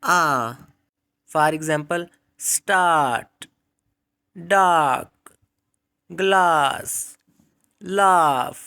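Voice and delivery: teacher demo of long aa, loud and stretched; long aa words. Shown as a demonstration. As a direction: draw out the aa in स्टार्ट. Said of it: "दूसरी मोनोफ्थोंग long vowel sound है".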